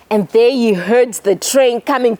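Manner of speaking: plain reading voice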